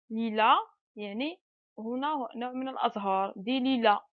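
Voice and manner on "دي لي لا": ordinary delivery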